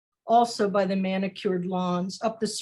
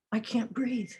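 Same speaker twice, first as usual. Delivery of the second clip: breathless, quiet plea; hushed gasping plea; 6 dB quieter than usual. Acted. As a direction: gasp it out as if smothered. Quoting victim being smothered.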